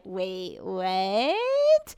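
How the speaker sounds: hammed-up incredulous double take; stunned disbelief. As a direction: draw the question out, sliding upward. Retold stunned reaction, drawn out.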